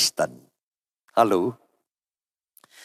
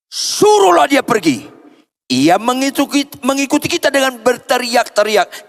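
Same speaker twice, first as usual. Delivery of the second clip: irritated demand; 9 semitones higher than usual. Acted. Annoyed disciples demanding she be sent away.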